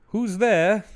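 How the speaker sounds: high sing-out mock call; polite calling out. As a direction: politely call into the dark, hammed-up. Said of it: "They're so polite about it".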